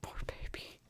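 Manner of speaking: whispered pity; whispered sympathy for the cat. Real, not acted. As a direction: breathe it out in a whisper. Pitying her cat on screen.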